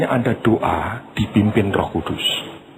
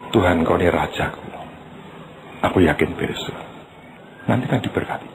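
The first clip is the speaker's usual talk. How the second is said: lower, calm, confident prayer; calm trusting prayer; 8 semitones lower, 3 dB quieter than usual. Acted. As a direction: wait on the King with quiet trust. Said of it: "Nantikanlah dia, belajar, percaya".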